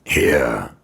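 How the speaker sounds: loud, eerie voice from underground; cold undead menace; deep, cold voice. A narrator's reading. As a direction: speak as if from out of the ground. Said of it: "deep and cold".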